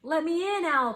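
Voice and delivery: crying out. A narrator's reading. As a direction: call out high and urgently. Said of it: "cried Mouse".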